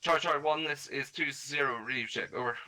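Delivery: loud radio operator call. Shown as a demonstration. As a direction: call it over the net, loud and crisp. Radio check call on net.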